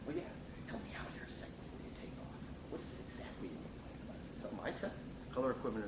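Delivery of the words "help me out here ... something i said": hushed, almost whispered, gently rising questions; soft empathetic uptone. Shown as a demonstration. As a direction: ease into a touchy question, softly and kindly. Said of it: "I kinda go uptone there to show the empathy".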